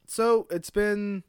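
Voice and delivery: normal talking voice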